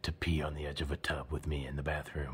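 low, hushed seducer; soft, deep smooth-talker voice. Shown as a demonstration. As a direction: murmur it low like a smooth lover. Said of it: "Here you go again".

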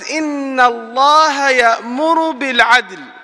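rapid chanted Quran verse; quick recitation. Acted. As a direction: recite the verse swiftly in Arabic.

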